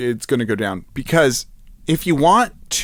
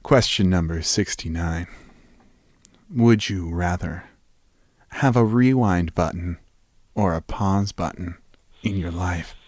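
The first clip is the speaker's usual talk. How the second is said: sexy voice; 6 semitones lower, 4 dB quieter than usual. Pretend. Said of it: "I'm gonna say this in my sexiest voice".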